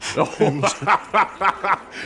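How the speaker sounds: put-on a pastor laughing cruelly at the boy begging for help; gloating laugh. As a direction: laugh at the boy's plea for protection. Pastor gloats over captured boy.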